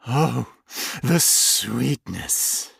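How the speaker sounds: slow, lightly voiced creature in mild rapture; a touch of ecstatic savoring. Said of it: "Its voice was ecstatic".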